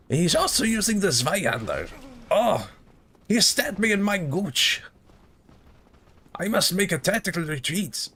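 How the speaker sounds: put-on high, manic German-accented professor; hammed-up high Germanic cartoon voice; crazy Austrian professor. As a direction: rant like an outraged mad professor. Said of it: "crazy Austrian, like, professor I do".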